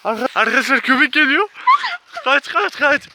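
high, loud alarmed shouting; panicked yelling. Real, not acted. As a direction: yell in panic as a dog chases you. Dog chasing them, yelling.

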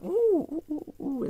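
high, delighted run of oohs; excited oohing. Real, not acted. Collector spots a Lego piece, high excited oohs. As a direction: ooh with rising excitement at a find.

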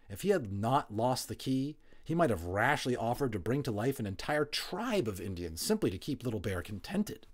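plain reading voice

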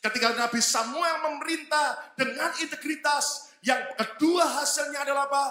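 high, loud, slow sermon build; full-force preaching. Acted. Preacher urging the congregation to repeat, loud slow preaching. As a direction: drive it home to the church.

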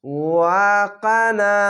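ওয়াকানা with the ka vowel held one beat; slow recitation. Shown as a demonstration. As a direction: chant ওয়াকানা slowly and evenly. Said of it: "এক আলিফ টেনে পড়বেন".

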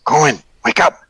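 loud call to wake him; urgent shout. Acted. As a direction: jolt him awake. Waking companion.

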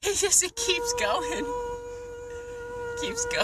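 high pained crying voice. Real, not acted. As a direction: whimper it through burning pain. Mouth burning from scorpion pepper.

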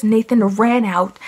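ordinary talk, nothing marked

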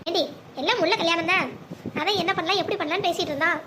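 ordinary talk, nothing marked

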